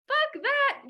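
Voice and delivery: sharp exclaimed rejection; emphatic exclamation. Real, not acted. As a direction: spit it out in outrage. Emphatic rejection mid rant.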